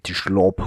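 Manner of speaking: "slop" with a slushy sh at the start; mock Scottish "slop", opening on sh. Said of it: "he says the S like sh".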